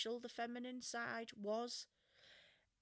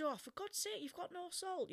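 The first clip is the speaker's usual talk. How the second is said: higher-pitched mock complaint; indignant retold grumble, pitched up; masculine exasperation; 9 semitones higher than usual. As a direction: imitate a blunt, exasperated customer. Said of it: "which would have been my masculine response".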